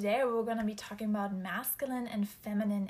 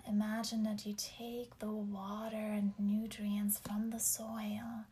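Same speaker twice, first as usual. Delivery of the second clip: slow, whispered meditation voice; guided meditation; slower than usual. Acted. Leads grounding meditation.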